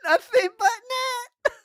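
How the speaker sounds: slow, very high, loud squeal; gleeful squeal. Real, not acted. Host crowing over the storyteller's predicted phrase, drawn-out ecstatic squeal. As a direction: shriek it in helpless delight.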